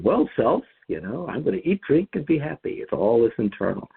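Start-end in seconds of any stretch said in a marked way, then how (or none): 0.0-2.8 lightly voiced self-satisfied rich man, higher; a hint of a rich man talking to himself; smug rich man. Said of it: "we have a rich man going".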